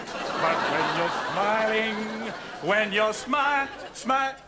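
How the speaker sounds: laughing through song. Acted. Host sings to partner and dissolves into laughter. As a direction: try to sing but crack up.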